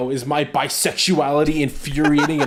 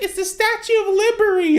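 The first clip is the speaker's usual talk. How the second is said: put-on much higher excited kid voice; child voice. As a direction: squeak it like a thrilled little kid. Kid in retold video.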